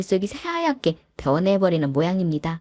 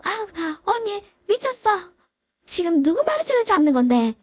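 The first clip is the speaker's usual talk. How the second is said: much higher, outraged yelp; voiced shocked sister-in-law; 13 semitones higher than usual. Sister-in-law protests her hair being grabbed, high and outraged. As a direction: squeal in outrage.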